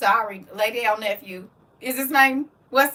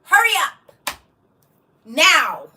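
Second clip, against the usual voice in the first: loud clipped orders; stern auntie command; 2 semitones higher, 4 dB louder than usual. Retold or imitated. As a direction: demand it, no arguing. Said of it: "I said".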